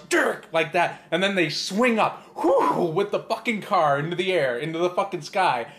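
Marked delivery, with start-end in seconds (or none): 0.1-0.5 high, loud imitated screech of the car jerking on the rope; sudden mock crash sound; car noise. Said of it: "the car goes".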